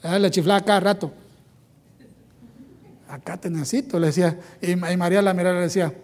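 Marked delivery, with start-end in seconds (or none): 3.1-4.0 low quiet call across the room; hushed beckoning. Retold or imitated. Marta quietly summoning Jesus, hushed. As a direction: call him over without disturbing anyone.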